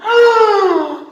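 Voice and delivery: chillido agudo y fuerte; grito de monstruo. Acted. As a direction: grita furioso. (monstruo chilla)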